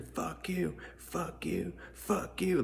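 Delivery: high, softened mock scream, over and over. Protesters screaming at a man in the video, imitated as a high mock scream. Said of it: "They're just screaming".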